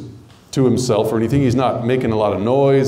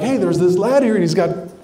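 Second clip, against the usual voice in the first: faith-filled; 6 semitones higher than usual. Retold or imitated. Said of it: "came with a bunch of faith".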